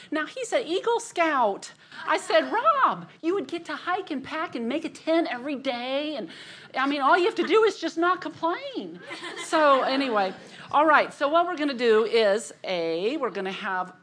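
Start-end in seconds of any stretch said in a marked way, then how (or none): 2.5-9.0 high, loud mock-pleading tease that breaks into a laugh; teasing husband. Retelling how she teased her Eagle Scout husband, high and laughing. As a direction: rib him, voice pitched way up and laughing at the end.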